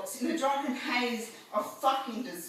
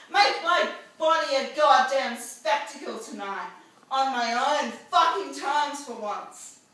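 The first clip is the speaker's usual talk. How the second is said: loud, raised-pitch declamation; defiant rallying cry; 4 semitones higher, 6 dB louder than usual. Acted. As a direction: proclaim it forcefully to the whole crowd. Defiant protest poem at full force.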